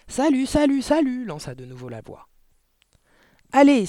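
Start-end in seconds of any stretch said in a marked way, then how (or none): 0.1-1.2 high parrot call ringing out; loud, clear voice. A narrator's reading. Hidden parrot startling the family at dinner, loud clear squawk. 3.5-3.9 high, loud charge; rallying cry. A narrator's reading. Mathilda leading the family into the room, loud cry. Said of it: "s'écria Mathilda".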